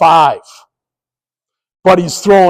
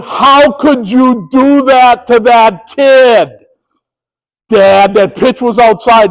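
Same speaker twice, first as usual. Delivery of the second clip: slow, higher voice of an indignant daughter; upset daughter; 4 semitones higher, slower than usual. Retold or imitated. Daughter scolding her umpire dad, slow and indignant. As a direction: protest like a hurt teen. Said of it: "My daughter ... going".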